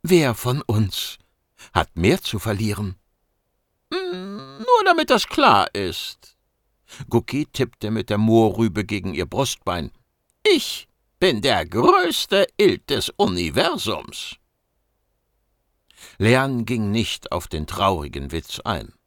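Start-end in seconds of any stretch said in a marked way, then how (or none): 3.9-6.4 much higher, squeaky cocky voice; a small furry know-it-all teasing; cheeky mouse-beaver. Acted. Gucki needling the agent, squeaky high and cocky. 10.5-14.4 higher, slow self-important squeak; boastful mouse-beaver. Acted. Gucki bragging to the agent, slow and squeaky.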